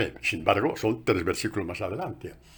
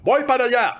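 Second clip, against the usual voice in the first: eager exclaim; 14 semitones higher, 8 dB louder than usual. Retold or imitated. Gideon eager to march.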